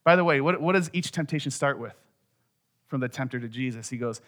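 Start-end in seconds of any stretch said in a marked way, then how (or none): none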